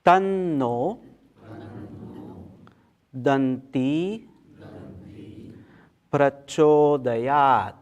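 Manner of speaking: intoned Sanskrit, long vowels stretched out; word-by-word mantra teaching. Shown as a demonstration. Teacher leading students through the mantra, word-by-word recitation. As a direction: recite each word deliberately for others to echo. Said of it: "one word at a time".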